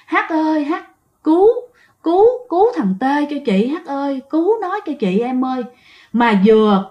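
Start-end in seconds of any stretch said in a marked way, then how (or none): 0.0-5.6 high, pleading ghost's cry, lightly voiced; a touch of a ghost woman calling out; ghost calling for help. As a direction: plead to Hát to save the boy. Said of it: "kêu rằng á".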